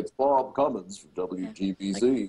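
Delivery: low, put-on announcer boom; low radio-man voice. Announcer introducing himself at a convention, imitated low radio-man voice. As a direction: outdo the other announcers for depth. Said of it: "talking as low as they could talk".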